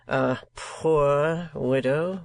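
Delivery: poor drawn out slowly, weighted; stress on poor. A narrator's reading. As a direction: lean hard on poor. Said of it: "a powerful emphasis on that little adjective".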